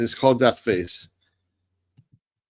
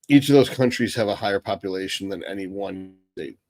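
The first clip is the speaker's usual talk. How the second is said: ordinary delivery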